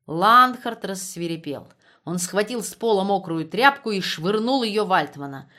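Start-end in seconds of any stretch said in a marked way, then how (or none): none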